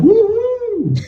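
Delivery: high gleeful woo-hoo; whooping. Retold or imitated. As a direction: whoop it like you're flying down the banister. Naked wife sliding down banister.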